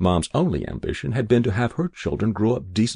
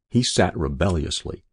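usual speaking voice

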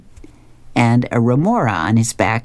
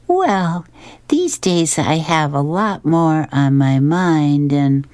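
high, slow wife voice, drifting off; cartoon wife dozing off mid-sentence; Blondie; 5 semitones higher, slower than usual. A narrator's reading. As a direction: start high and slow, sinking toward sleep. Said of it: "snuggling up, and she says".

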